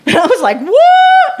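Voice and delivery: shouted, laughing disbelief; astonished laugh. Real, not acted. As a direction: burst out laughing in shock.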